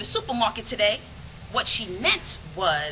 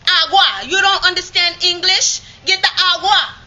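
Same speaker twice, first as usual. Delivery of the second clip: higher, much louder, fed-up shout; exasperated yell; 8 semitones higher, 11 dB louder than usual. Retold or imitated. Grandmother shouting at the girl in Spanish, exasperated yell. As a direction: bellow it like she's run out of patience.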